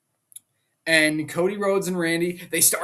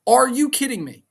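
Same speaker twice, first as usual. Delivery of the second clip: louder, higher, fed-up repeat; exasperated outburst; 7 semitones higher, 5 dB louder than usual. Real, not acted. Fan ranting to viewers about the twist, exasperated shout. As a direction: throw the question up in disbelief.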